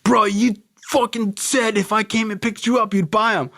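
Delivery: high-pitched, worked-up complaint; acted indignant protest. Dealer holds buyer to his promise, acted indignation. Acted quarrel over buying pills.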